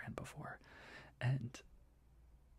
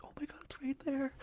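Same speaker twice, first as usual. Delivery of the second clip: amazed excitement; 13 semitones higher than usual. Retold or imitated. Spotting the marathon finish line.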